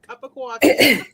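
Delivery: slow, loud New York accent on "Cup of coffee", drawing out "coffee" as "cawfee". Shown as a demonstration. Host jokingly repeats her guest's answer in a New York accent. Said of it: "Have to say it in the New York accent".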